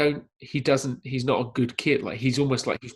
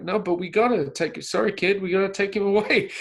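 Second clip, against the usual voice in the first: higher, insistent line reading; acting out a director reading opposite a child; Spielberg feeding lines; 8 semitones higher, 4 dB louder than usual. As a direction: press the kid in a raised voice. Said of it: "Spielberg is doing the lines".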